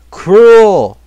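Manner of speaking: shouted complaint; loud outburst. Real, not acted. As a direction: yell it in disbelief. Reacting to game trap.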